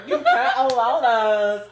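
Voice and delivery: ordinary talk, nothing marked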